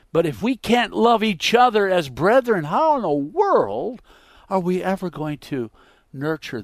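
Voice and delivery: high, loud pleading cry; preaching climax. Real, not acted.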